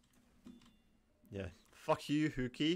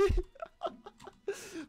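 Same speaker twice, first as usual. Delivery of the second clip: falling high-pitched laugh. Real, not acted. Gamer laughing at the spy about to backstab him, high laugh.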